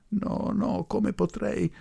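high, anguished plea at normal volume; desperate cry. A narrator's reading. Grieving daughter refusing the professor, desperate cry. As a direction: refuse in high-pitched despair. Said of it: "gridò Cesara ... disperatamente".

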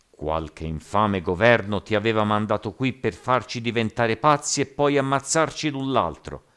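loud accusation climbing in pitch; angry clamour. A narrator's reading. Rioters accusing captain of a government plot, angry clamour. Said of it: "Un immenso clamore scoppiò".

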